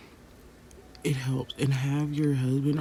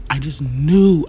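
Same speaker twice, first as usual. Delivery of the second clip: louder, slower insistence on knew; mother recalling her certainty, louder and slower; emphatic; 10 dB louder, slower than usual. Real, not acted. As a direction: hammer home that you knew.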